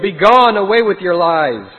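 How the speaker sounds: high, loud shout to drive off a foe; commanding yell. A narrator's reading. Shepherd driving the wolf off the sheep, commanding yell. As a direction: bellow it as a stern banishment. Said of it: "and a voice yelled".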